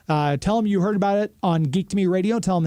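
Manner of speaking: normal talking voice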